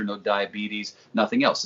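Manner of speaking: ordinary talk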